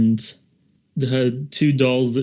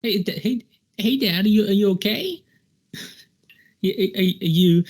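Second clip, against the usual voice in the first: put-on confused young son; 6 semitones higher than usual. Son snaps back, asks Dad.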